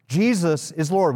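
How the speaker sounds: ordinary delivery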